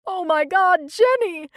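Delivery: loud, high anguished wail. A narrator's reading. Lourdes wailing over Jenny's body, loud wail. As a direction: let the grief break out in a wail. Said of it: "wailed Lourdes".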